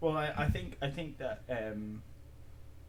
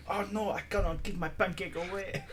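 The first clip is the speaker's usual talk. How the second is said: mock accent; 7 semitones higher, 5 dB louder than usual. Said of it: "pancake bit voice".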